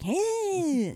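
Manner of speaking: put-on high, silly kids' party clown; chirpy party clown greeting. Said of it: "like a clown voice from a kid's party".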